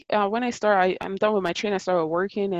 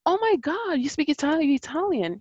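high-pitched retold amazement; excited surprise; 8 semitones higher than usual. To the man claiming five languages, excited. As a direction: act out eager, thrilled disbelief. Excited at supposed fellow Italian speaker.

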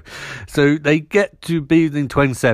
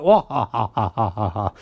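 staged "wah-ha-ha" cackle; mock villain laugh; 6 semitones lower than usual. Acting out villains taking Martin away.

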